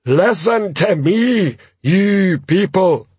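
slow, high-pitched troll yell; troll shouting. Acted. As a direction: call over a riot, drawing out each word. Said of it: "shouting at the top of his voice".

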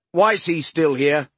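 loud slow barked question; barking. A narrator's reading. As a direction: bark it out. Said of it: "Gamble barked".

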